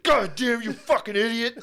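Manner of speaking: high angry outburst, imitated; startled spazzing. Startled man at son who grabbed his sides, furious spazzing. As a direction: blow up in mock fury. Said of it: "Phil spazzed".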